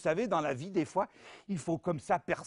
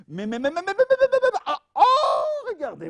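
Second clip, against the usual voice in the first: high, loud building stammered disbelief; mock astonished; 13 semitones higher, 10 dB louder than usual. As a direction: stammer in growing amazement, then shout. Astonished at nested box.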